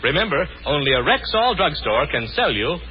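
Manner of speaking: usual speaking voice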